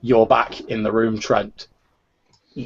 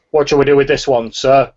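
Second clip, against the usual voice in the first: raised, brisk soldier voice; trooper's report; 4 semitones higher, 7 dB louder than usual. Acted. Trooper to his commanding inquisitor, loud and brisk. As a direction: bark it up to the officer.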